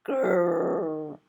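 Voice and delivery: tearful little growl; upset dinosaur growl; frustrated growl. Acted.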